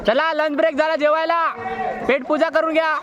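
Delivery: calling out. Real, not acted. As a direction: call everyone out for lunch. Announcing lunch break to crowd.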